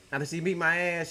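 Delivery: ordinary talk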